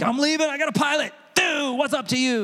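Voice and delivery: high, gleeful I'm-out-of-here announcement; excited bragging. Retold or imitated. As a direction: crow about landing the pilot. Excited quitting announcement to coworker.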